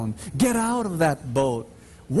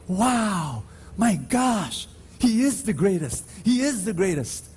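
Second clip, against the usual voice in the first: higher, awed oohs and wows; preacher voicing the awed crowd, pitched up; amazed crowd; 5 semitones higher than usual. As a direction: voice the crowd's wonder, lifted. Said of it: "And everyone was saying".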